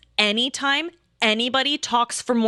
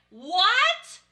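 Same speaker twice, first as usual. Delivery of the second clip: much higher, incredulous; stunned disbelief, acted; 10 semitones higher than usual. Table reacting to player storming off, stunned. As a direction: lift 'what' high in mock shock.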